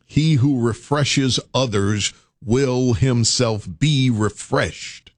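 slow, solemn saying; slow, measured proverb. Acted. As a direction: deliver it like scripture. Quoting a proverb.

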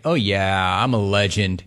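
low, smug self-praise; cocky. Retold or imitated. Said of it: "Taylor's like".

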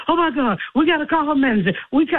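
imitated panic. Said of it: "And Bert almost panicked".

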